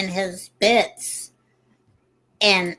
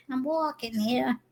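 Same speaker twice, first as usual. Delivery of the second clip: higher, drawn-out mock New York accent; terrible New York accent; 5 semitones higher, 5 dB quieter, slower than usual. Cohost repeating the phrase, slow bad New York accent. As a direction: badly fake a New Yorker, slowly. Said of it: "I do a terrible accent".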